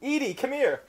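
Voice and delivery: high, loud excited summons; hammed-up "come and listen" call; mock calling out. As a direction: imitate calling someone over to hear. Mimics outsider calling someone over.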